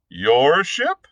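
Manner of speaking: a stunned echo of his words; the prisoner repeating Blood's claim, aghast. Acted.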